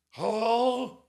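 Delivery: high, loud slurred hello; hammed-up drunk on the phone; mock drunk hello. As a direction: answer like you're wasted. Drunk friend answers phone.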